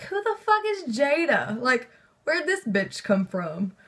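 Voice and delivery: high, slow disbelieving cry; retold shocked outburst. Reader on the phone to her friend, imitating her shock. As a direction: replay the shock of a stranger in the story. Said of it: "I was like".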